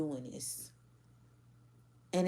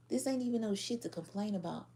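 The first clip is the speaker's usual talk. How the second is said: ordinary talk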